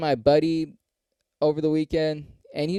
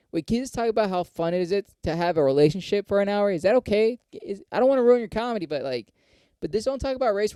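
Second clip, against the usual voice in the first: higher-pitched mock pleading; pleading club booker; 7 semitones higher than usual. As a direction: nag the comic with fussy little requests. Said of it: "That's all they're gonna say".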